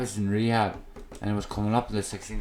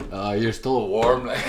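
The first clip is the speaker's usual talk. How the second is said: laughing; 4 dB louder, slower than usual. Real, not acted. Joke about quick Irish burials.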